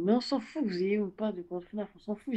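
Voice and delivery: ordinary talk, nothing marked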